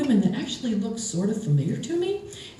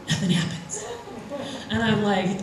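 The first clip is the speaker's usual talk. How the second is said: ordinary talk, nothing marked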